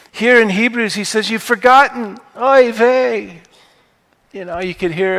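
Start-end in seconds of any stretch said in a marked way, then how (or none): 1.3-3.4 put-on Paul in a Yiddish-tinged accent, pitched higher and louder.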